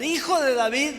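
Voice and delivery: grito fuerte y alto, súplica. Retold or imitated. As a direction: clama a todo pulmón por misericordia. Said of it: "Y volvió a gritar". (el ciego vuelve a gritar a Jesús, suplicando)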